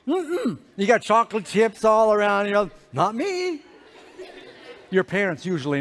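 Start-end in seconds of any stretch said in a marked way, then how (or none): 0.1-0.6 high hummed mock no; childish denial. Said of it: "And you're going". 2.9-3.6 high mock child's denial; guilty child acting innocent, pitched way up; feigned innocence. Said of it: "And you're going".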